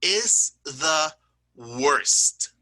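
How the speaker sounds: "is the worst" drawn out slowly, every word weighted; slow stressed superlative. Shown as a demonstration. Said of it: "but you notice".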